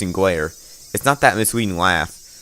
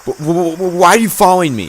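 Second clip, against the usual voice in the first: high, loud terrified stammer; stammering with fear; 9 semitones higher, 6 dB louder than usual. Acted. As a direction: stutter it out in terror. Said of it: "My voice is cold with fear".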